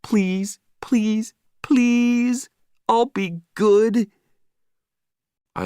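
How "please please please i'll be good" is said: high, pleading puppy voice, climbing louder; begging dog. Acted. The dog Marley begging his owner, high pleading voice. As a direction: beg like a dog desperate to swim. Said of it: "I could read his thoughts".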